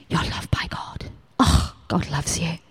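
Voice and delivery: whispered, gentle reassurance; tender whisper. Retold or imitated. Telling people God loves them, a soft whisper. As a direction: breathe it softly to someone. Telling others they're loved.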